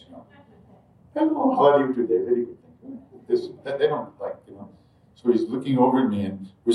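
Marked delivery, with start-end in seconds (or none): 1.1-3.2 polite, stiff Indian greeting, much higher; stiff formal greeting. Retold or imitated. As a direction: mimic a formal handshake hello. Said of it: "Indians aren't big huggers".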